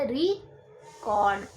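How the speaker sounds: Record with री stressed and cord light, slow; stress on first syllable. Shown as a demonstration. Teacher showing the noun stress, slow and stressed. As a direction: lean on री in record, slowly. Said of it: "ज़्यादा जोर दिया री पे".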